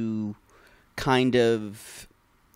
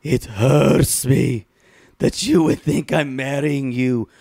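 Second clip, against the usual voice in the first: hammed-up hurt; 7 dB louder than usual. Fiancé protesting he won't divorce her, hammed-up hurt. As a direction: drag it out as a melodramatically hurt fiancé.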